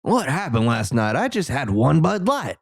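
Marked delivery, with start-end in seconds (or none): 1.1-2.6 put-on light Southern accent. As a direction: joke in a mild Southern voice. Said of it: "this Southern accent thing".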